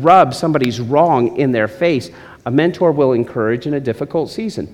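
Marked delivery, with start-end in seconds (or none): none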